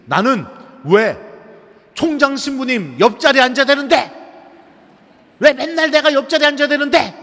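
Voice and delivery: high, loud outburst of indignation; retold angry shout. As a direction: yell it out like a sulky student losing his temper. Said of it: "소리를 빽 지르면서".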